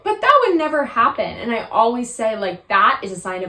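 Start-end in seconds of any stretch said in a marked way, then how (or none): 0.0-1.2 high, loud mocking doubtful voice; mock the girl who thinks it never happens. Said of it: "someone that would go".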